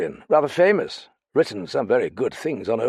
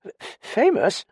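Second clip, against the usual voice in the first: stammering disbelief; 13 semitones higher, slower than usual. Acted. Dallings startled stammer.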